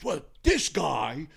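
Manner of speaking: lightly voiced grumbling big guy, drawn out slowly; slow mock gripe. [name] quoting the big guy explaining himself, slow and grumbling. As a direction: play the angry lifter, slowly and put out.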